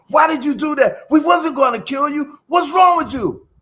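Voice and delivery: high, loud, indignant shout; yelling at the snake. Retold or imitated. Shouting at the cobra that bit itself, loud scolding. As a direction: holler it up high, scolding and baffled. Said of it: "yelled at the snake".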